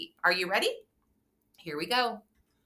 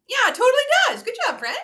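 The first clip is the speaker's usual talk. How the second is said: excited praise; 8 dB louder, much faster than usual. Acted.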